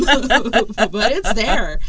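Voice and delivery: loud, slow words through laughter; laughing. Real, not acted. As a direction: push the words out while laughing. Laughing at her own tooth theory.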